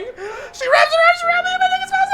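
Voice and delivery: high-pitched, laughing so hard the words squeak; real fit of giggles over the words; helpless laughing. Real, not acted. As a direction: try to read on while cracking up. Cracks up reading the scene aloud.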